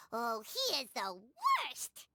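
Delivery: low, slow, drawn-out disgust; exaggerated cartoon disgust; disgusted groan. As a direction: drag it out like he's the worst person alive. Paimon complaining about Hiragi's father.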